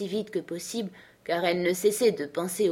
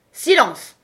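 loud, high cry for silence; shouted order; 8 semitones higher, 10 dB louder than usual. A narrator's reading. King calling the court to silence, shouting. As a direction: call the room sharply to order.

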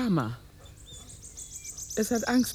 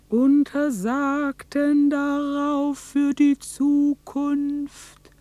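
slow, measured storyteller voice with long pauses; slow story narration; 5 semitones higher, 3 dB louder, much slower than usual. Acted. Narrator tells of the winter miracle, slow and solemn. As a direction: tell it like a solemn legend, word by word.

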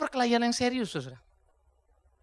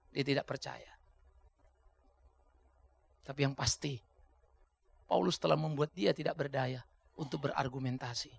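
usual speaking voice

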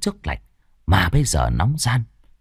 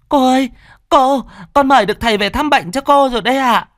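high and loud, pleased; eager maid calling out; 4 dB louder than usual. Acted. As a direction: announce you've brought a healer. Servant girl calls mistress.

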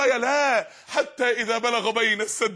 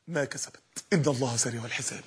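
much lower and softer, nearly breathed; hushed close; 11 semitones lower, 6 dB quieter than usual. Acted. Preacher closing the verse gently, hushed. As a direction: let the verse sink in.

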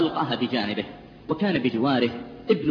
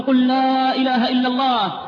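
higher, louder urgent plea; loud, higher pleading at a deathbed; 9 semitones higher, 5 dB louder than usual. Retold or imitated. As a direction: press him to say it, growing desperate. Doctor pleading again.

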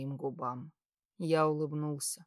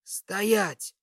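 higher, firm shouted command; barked order; 4 semitones higher, 4 dB louder than usual. A narrator's reading. Patient halting the orderlies from his bed, barked order. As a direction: bark it to stop everyone. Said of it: "рявкнул я".

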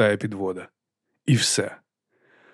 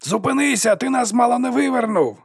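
high alarmed yell; shouting; 12 semitones higher than usual. A narrator's reading. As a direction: shout at the driver to stop, much higher. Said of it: "кричав спереду Толос".

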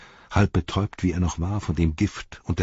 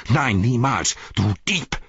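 furious accusation, moderately raised; screamed; 3 semitones higher than usual. A narrator's reading. Frodo to Sam, snatching the Ring back, furious cry. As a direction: spit out the refusal as an accusation. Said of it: "schrie Frodo".

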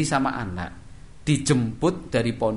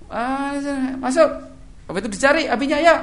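offhand parent; 5 dB louder than usual. Acted. Careless parent picking up child, high and offhand. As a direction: brush the child off casually.

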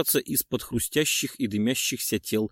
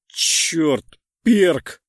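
loud, high anguished cry; anguished shout; 7 semitones higher, 8 dB louder than usual. A narrator's reading. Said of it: "повторил я".

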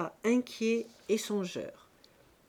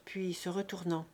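usual speaking voice

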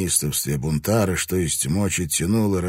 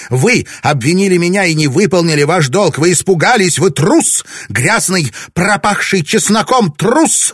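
loud, much higher scream; wife screams insults at her husband; furious screaming; 11 semitones higher, 10 dB louder than usual. A narrator's reading.

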